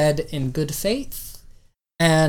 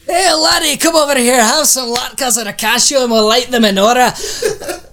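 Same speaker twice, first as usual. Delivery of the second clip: higher, louder, hammed-up Scottish brogue; Scottish accent; 6 semitones higher, 4 dB louder than usual. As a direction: play a jolly Scotsman offering food. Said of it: "took a left to Scotland".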